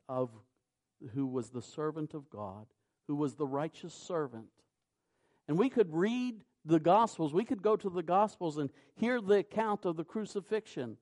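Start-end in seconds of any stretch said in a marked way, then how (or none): 5.5-11.0 louder, higher preaching push; rising preacher's fervour. Acted. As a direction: drive the point home from the pulpit. Preacher surges again.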